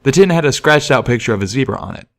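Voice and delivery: plain reading voice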